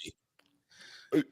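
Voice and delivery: slow unvoiced whisper; hushed slow denial, mock grief. Joking denial of Harden record.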